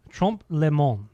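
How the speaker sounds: mock French pronunciation; hammed-up French accent. Shown as a demonstration. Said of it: "we're great with the French accent".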